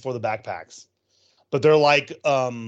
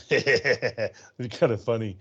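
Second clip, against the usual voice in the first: laughing; 2 semitones lower, slower than usual. Real, not acted. Repeats it, laughing.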